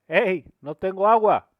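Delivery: loud, high call from upstairs. Retold or imitated. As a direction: shout up like a worker with no water. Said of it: "La de arriba dice". (grito agudo y fuerte de un trabajador)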